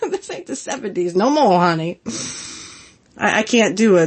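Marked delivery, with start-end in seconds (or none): 0.0-2.0 sassy teasing. Retold or imitated. Ribbing Disco at ringside in a sassy put-on voice. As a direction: tease him with a sassy "right?". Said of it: "it would be like".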